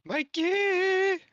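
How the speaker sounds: loud call. Real, not acted. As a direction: shout the name high and loud. Calling out to a friend.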